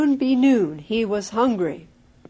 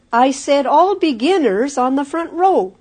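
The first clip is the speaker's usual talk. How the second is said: scolding teacher; 4 semitones higher, 5 dB louder than usual. Acted. Schoolmaster scolds boy.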